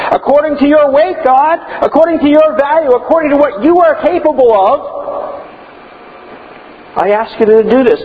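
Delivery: high, fervent praying voice; voicing Paul's prayer. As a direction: plead with God in awe. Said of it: "that's where he started off".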